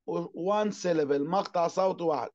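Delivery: normal talking voice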